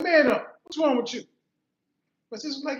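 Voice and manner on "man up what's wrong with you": loud retold telling-off; scolding. Sister telling crying brother to man up, loud scolding. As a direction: imitate a sister snapping loudly at her brother.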